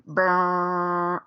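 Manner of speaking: imitated goal horn buzz; horn noise. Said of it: "Jim Rome style".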